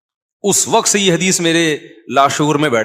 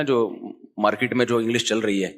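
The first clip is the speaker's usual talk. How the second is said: ordinary talk, nothing marked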